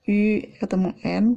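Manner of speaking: ordinary talk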